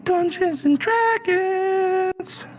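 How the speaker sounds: slow, very high drawn-out shout; dramatic announcement. Acted. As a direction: belt it like an epic intro.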